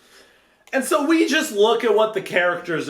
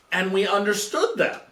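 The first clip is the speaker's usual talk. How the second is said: ordinary talk, nothing marked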